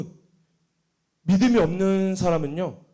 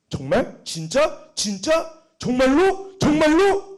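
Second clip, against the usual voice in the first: rapid questions climbing higher and louder; pressing interrogation, building; 7 semitones higher, 5 dB louder, much slower than usual. Retold or imitated. Grilling a group member, increasingly insistent questioning. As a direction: press harder with each question. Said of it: "이렇게 추궁을 한번 했었어요".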